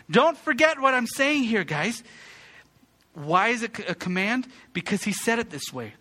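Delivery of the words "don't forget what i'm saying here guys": rejoice, rejoice, pitched way up and punched; playful scolding shout. Retold or imitated. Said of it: "as if he's saying this".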